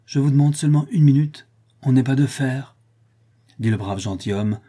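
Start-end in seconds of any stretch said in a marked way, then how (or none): 0.1-2.8 loud, higher, emotional plea; tearful protest. A narrator's reading. Squire wiping away tears asks for a minute, emotional. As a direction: plead for a moment to recover. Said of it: "en essuyant la trace de ses larmes".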